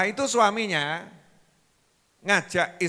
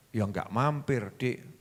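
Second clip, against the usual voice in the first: low, soft reply; meek husband; 8 semitones lower, 7 dB quieter than usual. Acted. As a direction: answer her meekly. Husband answers meekly.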